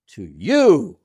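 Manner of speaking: "you" loud, with a big pitch swoop; emphasis on TO YOU. Shown as a demonstration. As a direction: punch and stretch "you". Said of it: "It's emphasizing the "to you".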